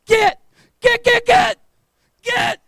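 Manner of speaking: high, loud repeated "Get!"; commanding shout. Retold or imitated. Cousin chasing the pit bull back, loud commands. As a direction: drive the dog off with each word. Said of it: "Only this time he goes".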